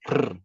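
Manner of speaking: lone "R" as a tongue-tip roll; vibrating trilled R. Shown as a demonstration. Teacher illustrating the vibrant sound, a bare rolled R. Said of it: "aquele vibrante".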